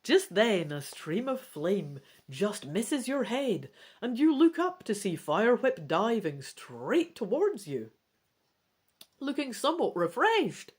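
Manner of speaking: narrating the dragon's surprise attack; dramatic story narration. A narrator's reading. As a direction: read the cliffhanger with rising drama. Climactic narration.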